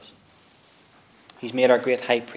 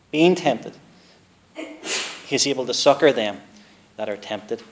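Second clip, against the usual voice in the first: scripture reading; 2 semitones higher, 6 dB louder than usual. Acted. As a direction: read it like old scripture, measured. Reads scripture aloud to congregation.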